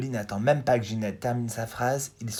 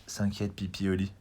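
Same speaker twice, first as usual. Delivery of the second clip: plain reading voice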